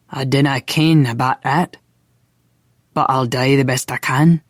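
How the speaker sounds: thick Scots brogue; mild Scottish burr; heavy Scottish accent. A narrator's reading. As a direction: speak in a broad Scottish accent. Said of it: "he'd fallen back into his heavy accent".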